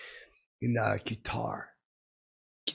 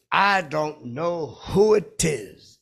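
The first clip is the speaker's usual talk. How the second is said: high, loud, drawn-out impression; slow, exaggerated celebrity imitation; Morgan Freeman; 6 semitones higher, 8 dB louder, much slower than usual. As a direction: boom it slowly, swooping up in pitch. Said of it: "He says like that".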